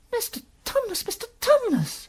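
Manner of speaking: put-on very high, distressed girl; great distress. Lucy calling to the howling faun, in great distress. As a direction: cry the name out like an upset child. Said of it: "said Lucy in great distress".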